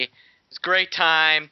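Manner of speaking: ordinary talk, nothing marked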